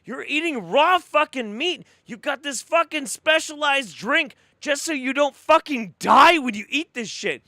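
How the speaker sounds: loud, high-pitched incredulous rant; angry rant. Real, not acted. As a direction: rant in exasperation at absurd food habits. Ranting at a diet influencer.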